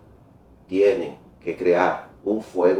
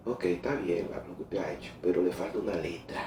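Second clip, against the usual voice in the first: much softer, lower retold voice; tactful correction; 4 semitones lower, 14 dB quieter than usual. América reviews the young leader's mural, quietly correcting. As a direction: drop right down to correct someone gently.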